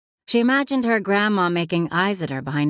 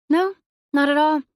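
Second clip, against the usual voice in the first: much higher, a bit louder quick denial; nervous denial; 8 semitones higher, 4 dB louder than usual. A narrator's reading. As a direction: deny it too brightly. Maeve lying, nervous.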